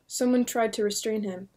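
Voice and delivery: plain reading voice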